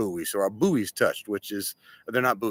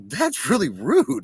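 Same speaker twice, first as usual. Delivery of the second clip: slow, higher, mock-wounded complaint; hurt friend; 9 semitones higher, 3 dB louder, slower than usual. Friend telling the boss he is rude, mock hurt and slow. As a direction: play the offended buddy, a touch hammed-up. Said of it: "he got all hurt".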